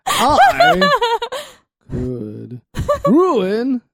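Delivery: every other word jumps to a squeaky high voice; alternating voices. Pretend. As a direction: flip between a squeal and a normal voice word by word. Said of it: "half and half, like, every other word".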